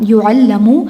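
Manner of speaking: "یعلمو" said yu'allamu, with u then a; present passive vowels. Shown as a demonstration. Teacher demonstrates present passive, "یعلمو" as yu'allamu. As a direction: give "یعلمو" the sounds u-a: yu'allamu. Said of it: "passive ہوگا او آ،".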